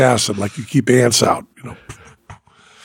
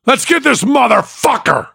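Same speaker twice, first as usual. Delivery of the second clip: high-pitched battle cry to the doctor; hollered rallying cry; gung-ho yell; 12 semitones higher than usual. Retold or imitated. Retold gung-ho patient urging doctor.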